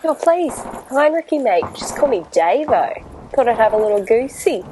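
cheery Aussie bloke; matey Australian banter; Australian accent. Acted. Australian captain Davo sketch.

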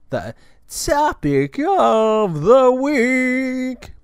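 loud, high, long drawn-out announcer call; segment announcer. Pretend. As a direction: stretch it out like a game-show announcement. Announcing the show's regular segment.

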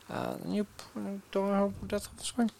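whisper. Acted. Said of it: "spooky aside".

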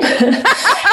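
high burst of genuine laughter; real laugh. Real, not acted. Mother recalling son's PlayStation answer, real laughter. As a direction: laugh out loud at the punchline.

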